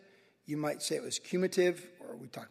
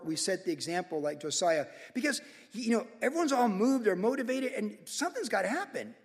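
ordinary talk, nothing marked

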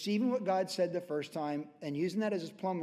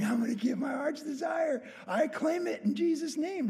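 mock claiming shout; 9 semitones higher than usual. Preacher mocking people who name and claim their wish, hammed-up shout. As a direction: ham up a pushy believer claiming it.

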